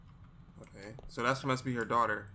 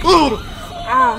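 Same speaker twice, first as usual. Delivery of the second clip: startled scream; 13 semitones higher, 20 dB louder than usual. Real, not acted. Jump scare and hitting eye.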